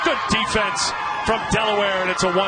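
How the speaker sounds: lively baseball announcer wrapping up the inning; excited play-by-play. Real, not acted. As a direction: call the defensive play with rising energy, then punch the recap. Live play-by-play call.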